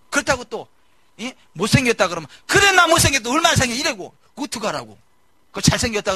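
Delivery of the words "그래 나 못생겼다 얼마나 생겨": mock angry retort. Mimicking an insecure person snapping back.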